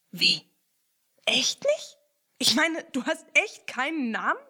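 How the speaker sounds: sudden high, loud disbelief; surprised. Acted. Ernie asks the rat in disbelief, loud and high. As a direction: gasp out the question in shock. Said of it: "fragte Ernie überrascht".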